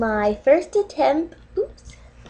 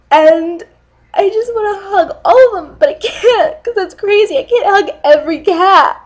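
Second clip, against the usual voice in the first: tearful; 5 semitones higher than usual. Real, not acted. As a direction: voice climbs into a high, crying plea. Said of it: "promised myself I wouldn't cry".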